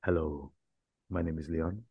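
low, soft, mock-seductive hello; sultry voice. Host joking about his cracked voice, mock-sultry. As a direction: purr the intro in a put-on sexy voice. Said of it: "sultry voice".

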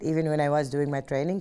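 normal talking voice

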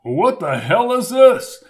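loud, high, drawn-out question; outraged demand. Acted. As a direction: demand an answer, stretching the words. Hamilton demands explanation amid chaos.

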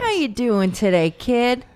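put-on high, loud friendly old man; cheery grandpa greeting a kid; happy old man. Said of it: "that's your happy old man voice".

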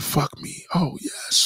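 breathy unvoiced whisper; whispered moan. Woman reenacting her bedroom talk for her friend, in a whisper. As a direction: whisper it like in bed.

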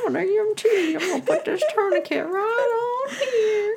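high squeaky cartoon voice, laughing; vintage cartoon voice. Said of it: "he did not talk like a cartoon character".

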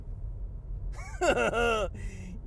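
a long, drawn-out giggle; goofy laugh. Real, not acted. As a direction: let the laugh roll on. Signature laugh after a punchline.

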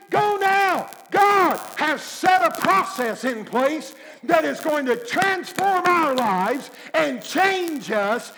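loud, urgent commands, building to go go go; urgent shout. Acted. Cornelius urging his servants, shouting urgently. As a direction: rush the servants out the door. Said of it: "and he said".

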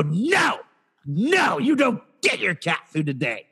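hammed-up refusal, high on the first no; mock stern refusal. Store staff denying a customer his cat food, mock stern. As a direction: turn them down with theatrical firmness.

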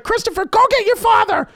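very high mock scream of alarm; imitated screaming mother. Mother calling her son during the fight, mock screaming. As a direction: shriek for help as the mother. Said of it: "I hear my mother screaming".

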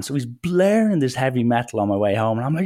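normal talking voice

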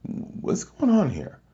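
high, slow, bewildered question; high drawn-out puzzled wonder; baffled. Retold or imitated. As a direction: drag it out, totally confused. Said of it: "I'm just sitting there like".

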